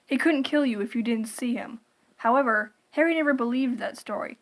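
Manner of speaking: usual speaking voice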